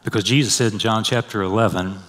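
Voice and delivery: ordinary talk, nothing marked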